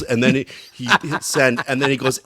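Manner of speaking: ordinary delivery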